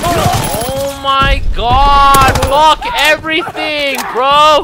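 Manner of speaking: long, loud, high drawn-out wail of frustration; exasperated yell. Real, not acted. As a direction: stretch every word in loud exasperation. Gamer despairs after dying.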